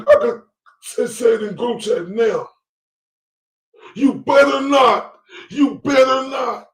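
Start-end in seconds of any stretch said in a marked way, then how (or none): 4.0-6.7 high, loud, slow fake sob; mock crying plea. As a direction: wail the warning like a weepy girlfriend. Commentator mimics the crying woman.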